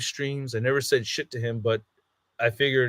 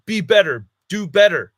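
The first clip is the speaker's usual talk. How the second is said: raised retort; 6 semitones higher, 8 dB louder than usual. Retold or imitated. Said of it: "and I was like".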